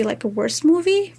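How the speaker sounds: ordinary talk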